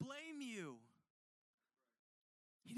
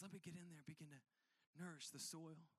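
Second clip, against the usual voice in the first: soft tender plea; 6 semitones lower, 8 dB quieter than usual. Retold or imitated. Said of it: "He says".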